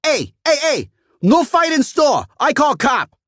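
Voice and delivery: put-on very high, loud foreign-accented scolding; scolding shopkeeper. A narrator's reading. Matt's mom warns the customer against fighting, scolding. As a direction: scold them to stop.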